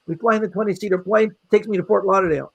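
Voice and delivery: ordinary delivery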